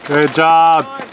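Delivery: ordinary talk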